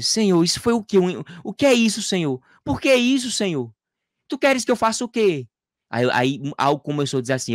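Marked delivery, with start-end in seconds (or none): 0.0-3.7 high, anguished questioning of God; tearful plea, re-enacted. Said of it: "comecei a chorar e dizer". 4.3-5.5 high, desperate question to God; tearful plea, re-enacted. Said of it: "comecei a chorar e dizer".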